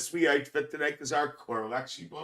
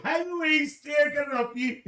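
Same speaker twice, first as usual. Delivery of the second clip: excited; 11 semitones higher than usual. Real, not acted. High excited greeting.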